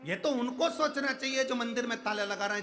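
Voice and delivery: preaching at full force. Acted. Preacher rallying devotees barred from the temple, fervent and loud. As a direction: rouse the whole crowd behind Mahadev.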